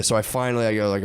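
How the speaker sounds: usual speaking voice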